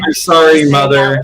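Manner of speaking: selling it. Acted. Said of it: "Sell it".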